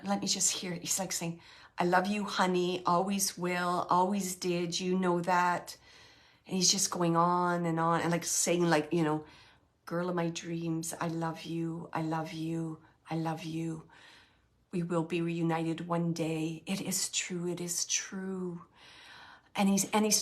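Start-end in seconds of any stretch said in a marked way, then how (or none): 1.8-5.8 soft, low, unhurried reassurance; hushed and loving; tender love message. Retold or imitated. Relaying husband's love message to wife. 9.9-18.6 very soft, low declaration of love; hushed, low and devoted; tender love message. Retold or imitated. Relaying husband's love message to wife.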